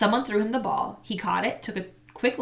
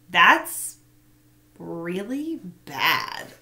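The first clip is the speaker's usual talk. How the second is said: put-on stunned boy; much slower than usual. A narrator's reading. Marshall shocked at rash.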